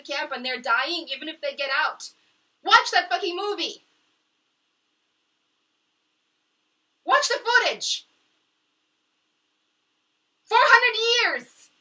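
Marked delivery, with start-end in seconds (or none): 2.6-11.7 loud, high, dragging each word slow; furious shout. Real, not acted. To the world, furious shout about the footage. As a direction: yell it out with everything. Angry outburst about injustice.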